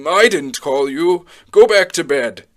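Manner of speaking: Eli/character. Acted. Old man sends the child back to bed, old man voice. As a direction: put on a sleepy old man's voice. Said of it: "in his normal old man Eli voice".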